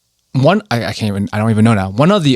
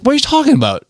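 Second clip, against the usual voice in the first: high-pitched indignant protest; arguing back, pitch shooting up; retold indignant disbelief; 13 semitones higher, faster than usual. Retold argument over an accent.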